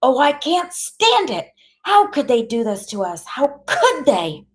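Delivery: high-pitched anguished outburst; distraught yelling. A narrator's reading. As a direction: wail it in upset disbelief. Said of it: "Graham yells".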